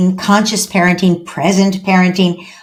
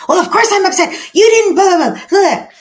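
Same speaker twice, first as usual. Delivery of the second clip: put-on high, loud, exasperated teen whine; upset teen; 12 semitones higher, 4 dB louder than usual. Teen daughter snapping at mom about a forgotten thing, high and indignant. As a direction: play the indignant fourteen-year-old snapping back.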